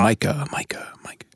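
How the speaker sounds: whispered echo fading away; echo effect. Pretend. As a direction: repeat the name like a fading echo. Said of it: "The little echo effect".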